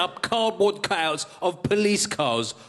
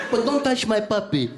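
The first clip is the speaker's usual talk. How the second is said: mock tough guy; 5 semitones higher than usual. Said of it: "a picture of a puppy behind him".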